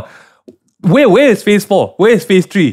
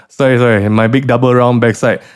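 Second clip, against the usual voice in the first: ordinary talk, nothing marked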